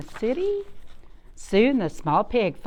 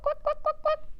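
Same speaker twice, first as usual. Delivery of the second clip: very high squeaky clucks; chicken clucking. Shown as a demonstration. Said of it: "chickens going cluck".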